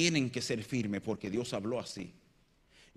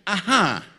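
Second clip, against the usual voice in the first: loud, falling "ajá" that says it won't work; dismissive wifely ajá; 5 semitones higher, 10 dB louder than usual. Acted. Wife doubts husband's idea, dismissive tone. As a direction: brush off the foolish idea with "ajá". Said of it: "eso es lo que te están diciendo".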